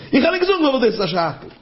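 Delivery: high, agitated retort; acted protest. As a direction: answer back with pitch leaping up. Acted indignant reply to questioners.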